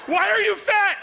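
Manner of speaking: shrill-pitched angry shout; mad wife yelling. Acted. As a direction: scold at full volume like a furious wife. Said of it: "She gets mad at you".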